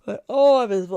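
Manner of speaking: usual speaking voice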